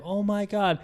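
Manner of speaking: high-pitched imitated scream at normal volume; mild mock scream. Child crying out at the trapped woman, mild mock scream. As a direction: act out a scream without the volume. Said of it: "So screaming the woman's name".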